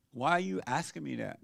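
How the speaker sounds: normal talking voice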